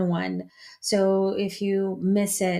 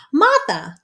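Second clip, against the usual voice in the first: high, louder mock Long Island pronunciation; Long Island accent; 7 semitones higher, 6 dB louder than usual. Host adopting her friend's saying for good, Long Island accent. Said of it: "I will always be saying".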